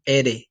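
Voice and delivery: "Ere" with a quick tongue tap; rolled R. Shown as a demonstration. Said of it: "You roll the tongue kinda quick".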